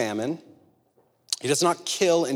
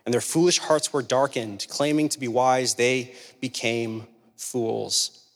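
lower, steady Bible reading; measured scripture reading; 4 semitones lower than usual. Acted. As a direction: read the passage solemnly. Reads Romans 1 aloud.

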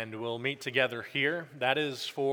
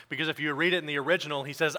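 raised, ringing pulpit voice; preaching; 3 semitones higher, 4 dB louder than usual. Acted. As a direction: preach it out to the congregation with conviction.

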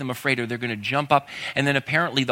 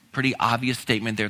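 ordinary talk, nothing marked

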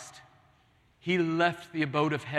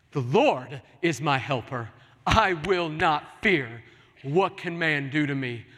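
bold proclamation; 4 dB louder than usual. Acted. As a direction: proclaim it to the whole church. Creed repeated as proclamation.

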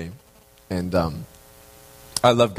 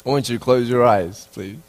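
guided meditation; 4 semitones lower than usual. Acted. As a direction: guide the congregation into the image gently. Leads a prayerful visualization.

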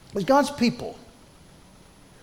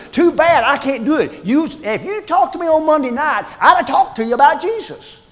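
mock dismissive churchgoer; 7 semitones higher, 5 dB louder than usual. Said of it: "it's sort of like in our mind thinking".

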